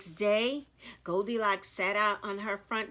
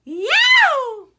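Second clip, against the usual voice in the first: much higher, loud shriek; 14 semitones higher, 13 dB louder than usual. A narrator's reading. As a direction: scream it in sudden fright. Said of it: "Goldilocks shrieked".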